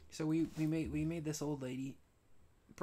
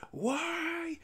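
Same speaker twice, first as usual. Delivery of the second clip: much higher, slow, whiny manager's question; fussy manager voice; 14 semitones higher, 4 dB louder, slower than usual. Retold or imitated. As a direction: play the prissy manager, drawing it out high. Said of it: "the managerial guy was like".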